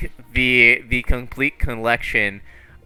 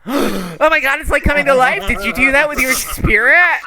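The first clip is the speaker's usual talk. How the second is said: high, loud gasping wonder; amazed girlfriend; 10 semitones higher, 4 dB louder than usual. Acted. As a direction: marvel at his spirit's magic. Amazed by waterfall illusion.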